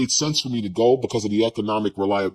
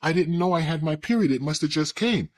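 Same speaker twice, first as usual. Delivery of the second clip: higher, mock surprised girl voice; a lightly voiced, fake-sweet girlfriend acting surprised; fake innocence; 7 semitones higher than usual. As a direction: play dumb in a put-on innocent voice. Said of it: "she pretends like".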